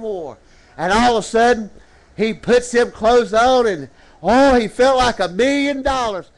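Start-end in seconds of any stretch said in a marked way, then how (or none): none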